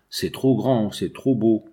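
plain reading voice

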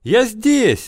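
high, desperate shout; frantic yell. A narrator's reading. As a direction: scream to be found. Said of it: "он орал".

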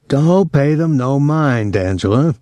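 slow, high tenor soldier. A narrator's reading. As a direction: lift it into a lightly voiced tenor drawl. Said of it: "He had a high tenor voice".

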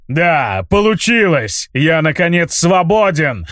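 slow, booming triumphant spirit. Acted. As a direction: roar it out as a freed giant spirit. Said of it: "прогрохотал".

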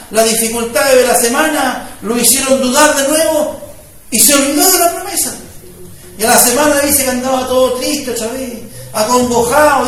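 loud, impassioned sermon voice; fervent preaching. Acted. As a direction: preach it out to the congregation. Preacher building to full force.

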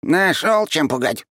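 high, scornful snort; scoffing creature. A narrator's reading. Creature scoffs at the flame, high and dismissive. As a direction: brush off the threat with a high snort. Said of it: "фыркнул он".

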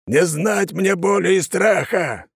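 slow, high, ringing oath; solemn oath. Acted. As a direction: proclaim it like a sacred vow. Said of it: "продолжил он". (торжественная медленная клятва)

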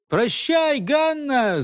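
mild calling out. A narrator's reading. A lad calls farewell to Ганна, mild high cry. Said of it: "закричал в это время один из парубков".

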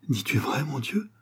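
hushed, awed question, lifting at the end; soft, overwhelmed half-whisper; stammered disbelief. A narrator's reading. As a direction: ask it faintly, overcome and incredulous. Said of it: "balbutia lord Weymouth".

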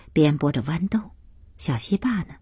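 usual speaking voice

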